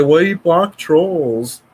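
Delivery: high, hammed-up whiny troll; mocking troll voice. Mocking the trolls who say he blocks everyone. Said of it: "And they say".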